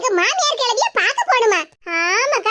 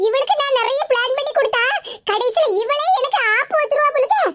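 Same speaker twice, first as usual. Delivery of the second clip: ordinary talk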